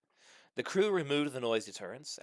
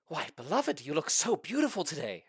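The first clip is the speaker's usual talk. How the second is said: exclaiming; 6 semitones higher than usual. Acted. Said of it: "Herbert exclaimed".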